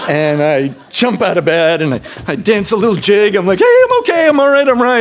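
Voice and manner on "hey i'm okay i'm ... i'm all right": high, loud and bright; cheerful reassurance. Retold or imitated. Teen in the hospital reassuring his pale brother, chirpy and loud. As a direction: bounce up and chirp that you're fine.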